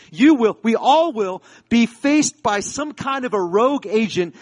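high, loud, driving sermon delivery; full-force preaching. Real, not acted. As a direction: preach it hard, punching the key words.

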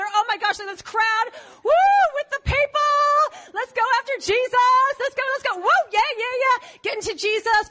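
high, loud whooping crowd cheer; excited cheering follower. Acted. Follower cheering the crowd on to chase Jesus, high and loud. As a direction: whoop like a fan chasing a star.

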